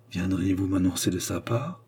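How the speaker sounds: usual speaking voice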